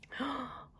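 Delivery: soft audible intake of breath; gasp. Real, not acted. Teacher delighted by seven colors, gasping. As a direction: gasp in wonder, softly.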